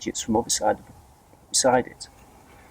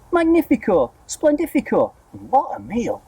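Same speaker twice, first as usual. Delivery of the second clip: very high gleeful squeal; excited cry; 12 semitones higher than usual. A narrator's reading. Aunt squeals over the peach, greedy and gleeful. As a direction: gloat over the meal to come. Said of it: "shouting all sorts of silly things".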